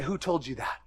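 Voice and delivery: a lightly voiced, tender God asking; God's voice. God answers the daughter, low and soft. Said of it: "And God said".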